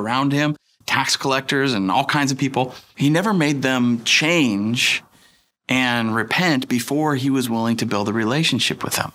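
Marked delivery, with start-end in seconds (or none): none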